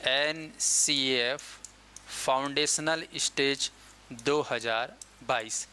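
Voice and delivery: drawn out word by word, with pauses; slow model phrase. Shown as a demonstration. Teacher showing students the short name to say, slow and deliberate. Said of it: "इसको shortcut में हम लोग बोलेंगे".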